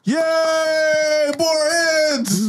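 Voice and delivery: very high, drawn-out hurrah; mock crowd celebration, squealed long; crowd cheer. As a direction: cheer it high, holding the vowels. Crew cheers the food plan.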